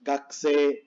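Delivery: gakusei squeezed to "gaksee"; silent U. Shown as a demonstration. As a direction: drop the U in gakusei, long E ending. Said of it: "suena como doble E".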